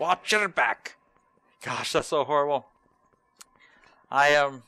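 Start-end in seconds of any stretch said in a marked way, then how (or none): 0.0-1.0 mock gruff echo of the game's line; imitating NPC line. Streamer copying the NPC's farewell, hammed mock voice. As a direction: parrot the warning in a hammed voice. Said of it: "I am not set to be a voice actor".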